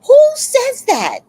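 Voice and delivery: loud, high disbelief; high-pitched, incredulous yell; incredulous outburst. Real, not acted. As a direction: exclaim it in sharp disbelief. Outraged reaction to neighbor's remark.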